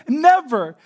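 very loud, high exclamation; emphatic testimony. Retold or imitated. Preacher quoting a believer's emphatic denial, fired up. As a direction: punch it out with total conviction.

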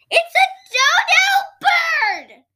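very high, loud, slow squeal; excited shriek. Acted. Child shrieking at a dodo bird, amazed. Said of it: "I shouted".

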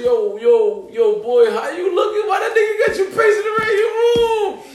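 imitation climbing very high and loud; mock incredulous shout. Friend asking host about pacing, imitated incredulous shouting. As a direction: holler the questions like a stunned friend. Retells friend's astonished reaction.

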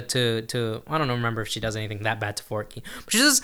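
usual speaking voice

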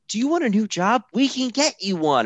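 high-pitched mock TV sales pitch; infomercial pitch. Voices a pushy infomercial pitch.

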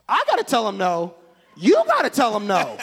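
loud, high protest; mock indignation. Husband refusing to tell 'em no, indignant. Acted argument with wife.

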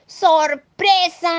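high, loud, stretched cheer; slow, ringing party shout; surprise shout. Acted. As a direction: call it out high and drawn out.

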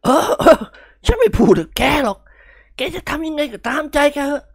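wash your hands of him, huffing; exasperated uncle. Acted. Uncle fed up with nephew.

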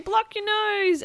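high, loud, drawn-out warning; high storybook cry of alarm; warning balloon. Acted. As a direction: call it out in comic alarm. Balloon warns about the stink.

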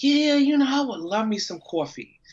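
put-on high, loud New York accent; thick New York accent. Imitating relative's answer about coffee, thick New York accent. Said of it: "it's so thick".

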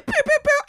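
high, loud pew pew sounds; laser pew noises. Pretend. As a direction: make laser gun noises.

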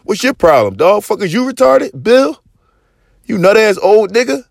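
mock tirade rising to a high yell; imagined furious rant. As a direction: go off on him like a dad defending his son. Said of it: "That's how my pop was supposed to ride".

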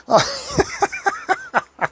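very high cackling laughter; high shrieking laugh. Retold or imitated. Imitating the fat women laughing at him, high cackle. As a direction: let out a high hooting cackle.

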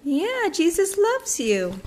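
high, slow and gentle. Real, not acted. Parent speaking to a toddler.

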